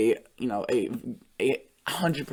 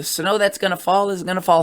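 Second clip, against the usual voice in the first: voice crack; 5 semitones higher, 6 dB louder than usual. Real, not acted. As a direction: voice cracking high on heavily. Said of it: "Sorry about that voice crack there".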